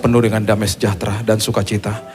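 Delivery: loud, projected congregational prayer; leading prayer. Real, not acted. Leads the closing congregational prayer. (doa penutup yang lantang untuk jemaat)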